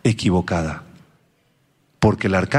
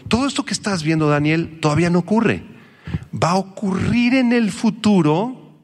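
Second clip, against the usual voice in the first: lightly voiced angel; 8 semitones higher than usual. Gabriel explains to Daniel.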